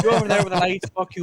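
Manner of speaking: high, loud mock rant. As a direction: imitate a furious actor yelling.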